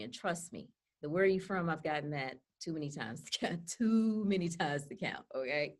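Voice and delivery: normal talking voice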